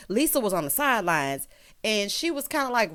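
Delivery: normal talking voice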